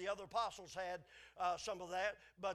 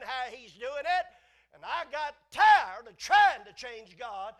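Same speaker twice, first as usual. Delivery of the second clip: rising louder preaching burst, slowed; building shout; 4 semitones higher, 17 dB louder, slower than usual. Real, not acted. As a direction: drive it home at full force.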